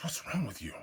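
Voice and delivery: whispered, soft and sharp. Retold or imitated. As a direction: hiss the question under your breath, no voice. Girlfriend confronting him quietly.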